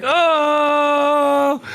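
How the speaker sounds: very high, loud exaggerated "Oh!"; mock delighted gasp. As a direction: squeal it like a cartoon surprise. Regular show moment reaction.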